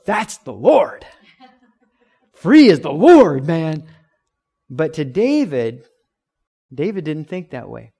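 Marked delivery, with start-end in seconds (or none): 0.0-3.9 mocking, breezy "free is the Lord, man"; mock careless giver. Preacher mocking a careless giver, loud and breezy. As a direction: lampoon a giver who hands God what cost nothing.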